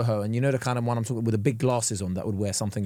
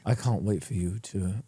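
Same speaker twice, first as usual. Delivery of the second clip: mock seductive; 6 semitones lower, 5 dB quieter, slower than usual. Mock seductive line attempt.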